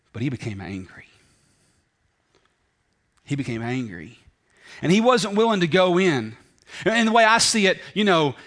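ordinary delivery

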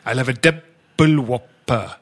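slow drawn-out Welsh order at the counter; mock Welsh accent, slow. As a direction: stretch the syllables in a Welsh accent. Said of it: "That's how you do a Welsh accent that way".

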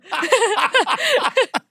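high burst of laughter; cackling laughter; real laughing fit. Real, not acted. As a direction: crack up helplessly. Group cracks up at story.

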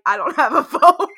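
giggling mid-line; laughing through a quote. Retold or imitated. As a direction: break into laughter on the punchline. Din voiced, speaker cracking up.

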